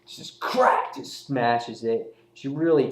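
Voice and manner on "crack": drawn-out comic-book crack; cracking sound effect. Retold or imitated. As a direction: stretch the word into a smashing sound effect. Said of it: "You can see the word expression".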